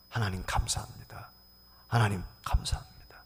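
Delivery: hushed, reverent whispered prayer; whispered thanks. Retold or imitated. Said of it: "하나님 앞에 감사하는 순간". (속삭이는 감사 기도)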